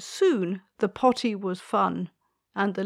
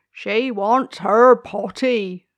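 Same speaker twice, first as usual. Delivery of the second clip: louder, slow call across the garden; loud cry; 2 semitones higher, 10 dB louder, slower than usual. Acted. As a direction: bellow it out slowly. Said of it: "cried the gardener".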